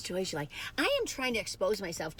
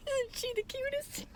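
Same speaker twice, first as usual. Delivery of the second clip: much higher, slow doting question; gushing over the dog; slower than usual. Real, not acted. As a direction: coo it at the dog.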